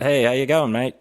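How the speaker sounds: punchy mock-Australian accent; Aussie voice. Shown as a demonstration. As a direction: hail a mate in broad put-on Aussie. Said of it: "I'll do an Aussie voice".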